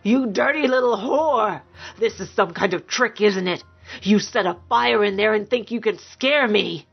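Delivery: scornful rage, contained in volume; angry tirade. A narrator's reading. Violent man accusing his lover of a trick, angry. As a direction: spit accusations at her, disbelieving. Man rages while beating her.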